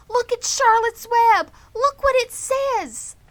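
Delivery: put-on high, loud eager girl's cry; excited cry. A narrator's reading. Fern calls her family to the web, thrilled. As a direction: call out to the grown-ups, thrilled. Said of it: "cried Fern".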